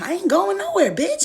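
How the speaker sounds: high, loud defiant shout; defiant yell. Retold or imitated. Girl refusing to leave, shouting back at Flo. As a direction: yell back that she's not going anywhere.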